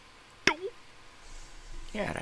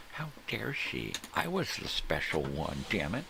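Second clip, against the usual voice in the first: higher, hammed-up wounded pride; mock indignant brother; 7 semitones higher than usual. Mocking the jealous brother who was outshone by his sister, in a higher, hammed-up voice. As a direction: whine like a spoiled sibling.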